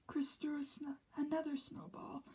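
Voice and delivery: usual speaking voice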